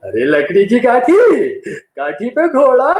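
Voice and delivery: slow, loud, menacing recital of the rhyme; a mock villain booming the kids' rhyme; angry villain roar. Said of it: "इतना गुस्से से क्यों बात?".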